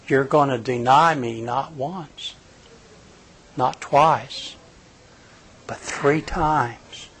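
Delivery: mild tearful prophecy, softly; tearful Jesus. Retold or imitated. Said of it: "with tears running down his face".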